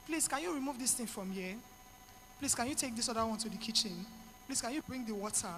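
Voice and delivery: soft, low-pitched polite request; low calm voice. Shown as a demonstration. Stressed mother asking family to help in the kitchen, low and calm. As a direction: keep it hushed and low, asking gently. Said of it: "use a very low pitch".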